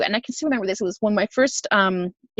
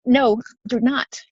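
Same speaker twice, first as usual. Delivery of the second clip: ordinary delivery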